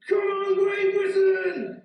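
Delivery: mimicking the roaring crowd; crowd shout. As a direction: bellow it like fans at the finish. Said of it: "they're saying".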